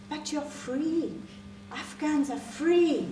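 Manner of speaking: high, slow, stretched-out insistence; confused zealous stranger. Acted. Confused woman insisting to Afghan teacher, high slow insistence. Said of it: "She looked confused".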